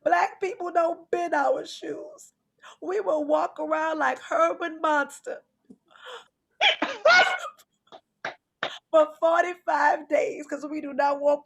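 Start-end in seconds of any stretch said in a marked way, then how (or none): none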